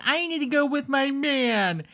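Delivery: high, loud mock-whiny impression; exaggerated high-pitched imitation, dragging out the end; mocking whine. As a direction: wail it like a lovesick drama queen, mocking her. Mockingly quotes the other woman.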